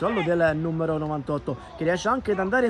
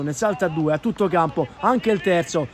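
ordinary talk, nothing marked

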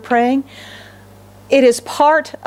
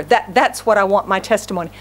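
ordinary talk, nothing marked

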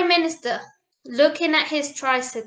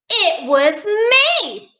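slow, high, drawn-out reveal; storybook little prince owning up; prince's confession; 6 semitones higher, 4 dB louder, much slower than usual. A narrator's reading. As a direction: confess slowly, swooping up.

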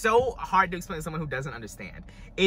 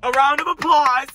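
louder, higher lyric call; 6 semitones higher, 5 dB louder than usual. Retold or imitated. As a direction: call out the line like the diva on stage. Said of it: "She said".

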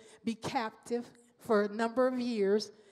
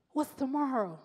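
ordinary delivery

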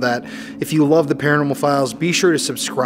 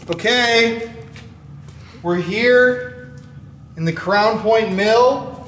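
higher, slow announcing voice; formal investigator intro; 9 semitones higher, much slower than usual. Acted. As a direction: announce yourself to an empty room.

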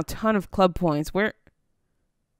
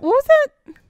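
high, louder startled yelp; 7 dB louder, slower than usual. Real, not acted. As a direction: jump at the sudden noise. Strange sound in game.

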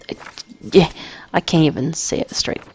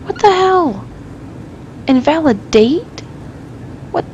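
shocked outburst; 9 semitones higher than usual. Real, not acted. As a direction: shoot up in startled disbelief. Shocked by invalid date.

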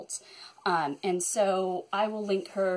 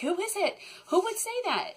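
pitched way up, wondering who it was; a high, baffled who-could-it-be; puzzled surprise; 9 semitones higher than usual. Retold or imitated. Wondering aloud who liked all her videos, surprised and high. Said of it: "I'm like".

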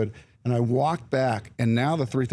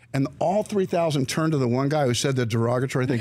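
normal talking voice

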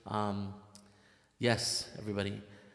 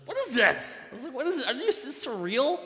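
high, astonished voice of disbelief; reacting to a shocking email, high and stunned; retold disbelief; 10 semitones higher than usual.

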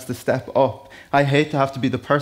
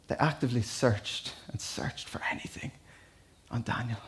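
hushed whisper; 2 semitones lower, 13 dB quieter than usual. Real, not acted. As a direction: drop to a dramatic whisper for the congregation. Dramatic hush telling Daniel's story.

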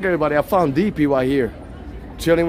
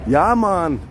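drawn-out Jamaican "yeah, man"; fake Jamaican accent; 2 semitones higher than usual. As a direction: toss out a mock Jamaican catchphrase. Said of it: "My fake Jamaican".

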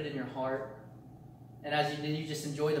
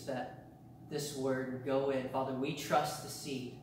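prayer; 2 semitones lower, 5 dB quieter than usual. Acted. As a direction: pray quietly over the church. Closing prayer.

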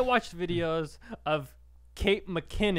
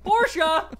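much higher, louder call of Portia; Ellen impression; 14 semitones higher, 6 dB louder than usual. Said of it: "Ellen DeGeneres".

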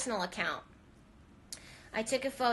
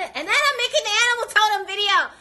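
much higher and much louder, bursting with excitement; excited outburst; 12 semitones higher, 13 dB louder, faster than usual. Real, not acted. Telling viewers about her next video, loud and excited. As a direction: launch into it like a big reveal.

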